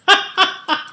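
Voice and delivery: a short high-pitched laugh; high giggle. Real, not acted. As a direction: giggle at yourself. Laughing about her perfumed purse.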